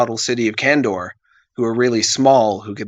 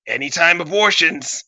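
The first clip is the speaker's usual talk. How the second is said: raised, falling cocky announcement; breezy catchphrase; 6 semitones higher than usual. Acted. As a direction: toss it out like a slogan.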